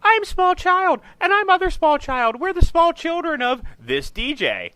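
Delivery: put-on very high, loud little-kid voices; squeaky, loud child impression; small children in radio liner. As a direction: chirp it out like kids on a station promo. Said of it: "the liner that was on the station".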